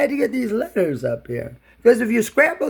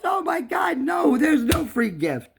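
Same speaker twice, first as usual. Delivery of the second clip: dismayed; 6 semitones higher, 4 dB quieter than usual. Real, not acted. Dismay at missing gift.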